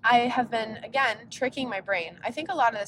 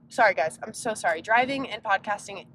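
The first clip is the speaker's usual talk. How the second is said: usual speaking voice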